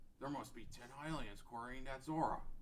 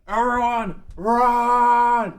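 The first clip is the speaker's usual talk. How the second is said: full-throated warning shout, much higher and louder; 11 semitones higher, 21 dB louder, much slower than usual. Acted. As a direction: yell it to the whole mountain. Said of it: "shouts to the Gorons".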